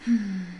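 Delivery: soft, contented sigh; tender sigh. A narrator's reading. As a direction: let out a quiet, fond sigh. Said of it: "sighed a tender sigh".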